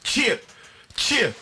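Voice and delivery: mock cheer.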